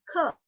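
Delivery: Ke with a puff of air after the k; aspirated ke. Shown as a demonstration. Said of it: "Ada aspirasinya".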